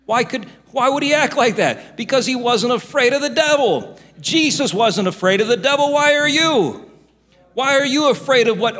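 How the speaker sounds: loud, high-pitched preacher challenge; full-force preaching. Real, not acted.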